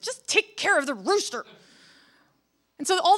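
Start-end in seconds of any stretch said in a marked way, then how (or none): 0.0-1.5 angry order, only slightly raised. A narrator's reading. Angry sultan to his guards about the rooster. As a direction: snap it out with some anger. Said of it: "very, very angry and said".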